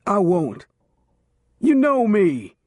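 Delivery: high, loud panicked pleading; desperate begging. A narrator's reading. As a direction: beg for your life, voice cracking. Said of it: "Bigelow begged".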